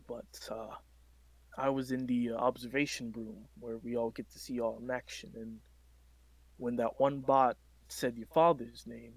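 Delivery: hushed confiding counselor. Acted. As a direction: lean in and speak softly. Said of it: "lowers his voice".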